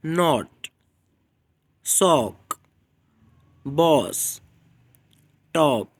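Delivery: सॉक, बॉस, टॉप with the short o of top; teacher demo of short o; short o words. Shown as a demonstration. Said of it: "For example".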